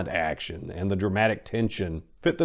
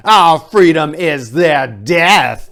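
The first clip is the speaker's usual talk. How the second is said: slow, loud, higher chanted answer; shouted reply; 9 semitones higher, 14 dB louder, slower than usual. Acted. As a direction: roar it back as the whole army. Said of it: "they replied".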